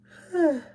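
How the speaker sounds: big loud yawn. Real, not acted. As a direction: let out a long loud yawn. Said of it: "Excuse me for keep on yawning".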